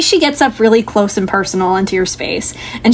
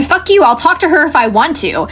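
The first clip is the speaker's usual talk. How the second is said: raised-pitch defiant outburst; agitated defiance; 6 semitones higher than usual. Acted. Someone in a commotion outside defying Carol, agitated. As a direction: argue back hotly, pitch up. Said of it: "you hear Carol trying to calm somebody down".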